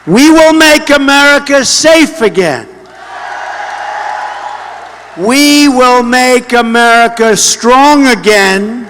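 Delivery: booming slogan delivery to a roaring crowd; rally speech. Real, not acted. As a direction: punch each promise out to the crowd. Rally crowd, chanted campaign slogans.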